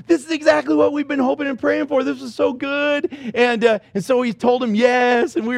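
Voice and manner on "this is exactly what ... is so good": higher, eager telling the wife; thrilled announcement to his wife; excited. Retold or imitated. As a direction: burst with happy relief. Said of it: "I'm like".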